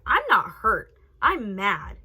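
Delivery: loud, wailed protest; acted-out angry wail; mock upset outburst. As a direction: insist loudly, as if mad to the point of tears. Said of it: "sitting out in the hallway fucking sobbing".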